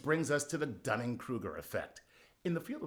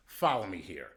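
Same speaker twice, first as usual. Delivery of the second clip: usual speaking voice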